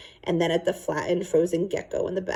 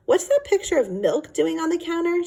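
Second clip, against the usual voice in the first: puzzled mother; 11 semitones higher than usual. Acted.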